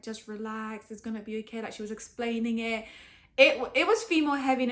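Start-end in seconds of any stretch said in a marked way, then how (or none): 0.0-1.6 much softer, gentle reassurance; calm her down like a kind nurse; soothing nurse. Retold or imitated. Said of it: "she was like".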